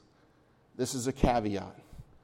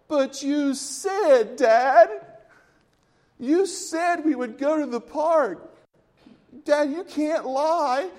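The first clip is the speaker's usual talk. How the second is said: put-on whiny kid; 10 semitones higher, 5 dB louder, slower than usual. Child protesting to dad.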